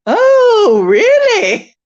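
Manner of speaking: very high, loud drawn-out squeal; hammed-up scandalized exclamation; mock shocked reaction. Imitating people who took it the wrong way, mock shock. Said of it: "People just were".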